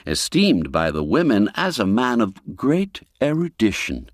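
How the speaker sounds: plain reading voice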